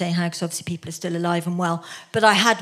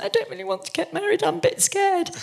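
tearful; 10 semitones higher than usual. Retold or imitated. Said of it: "burst into tears and went".

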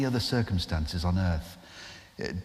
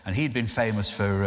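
ordinary talk, nothing marked

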